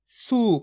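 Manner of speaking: सू with a long, drawn-out oo; long vowel. Shown as a demonstration. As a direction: stretch the vowel in सू. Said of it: "खींच के पढ़ेंगे".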